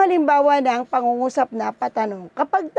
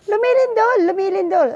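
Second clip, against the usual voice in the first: lively alarm; 6 semitones higher than usual. Shown as a demonstration. Teacher showing students how to read an exclamation, with alarm. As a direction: call it out like the ground is shaking. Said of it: "dapat yung pagbasa mo dyan ay may buhay".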